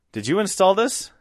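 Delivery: high, rising incredulous question. Retold or imitated. Boss questioning new installer's work, incredulous.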